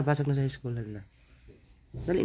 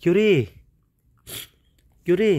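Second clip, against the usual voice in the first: calling out; 4 semitones higher, 6 dB louder than usual. Real, not acted. Calling child to wake up.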